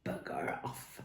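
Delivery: whispered sneer. Acted. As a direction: hiss it contemptuously. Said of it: "Peg sneered".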